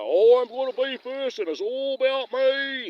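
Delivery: high, slow, loud snooty mimicry; mocking an entitled woman. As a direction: ham up a self-important woman. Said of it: "she even talked like that".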